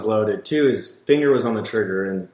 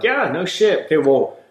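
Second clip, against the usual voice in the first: raised, louder mock-fed-up telling-off; imitating snapping at a clumsy client, pitched up and loud; exasperated retort; 5 semitones higher, 5 dB louder than usual. Guide retelling what he told the hunter, raised exasperated mock retort. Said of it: "And, and I'm like".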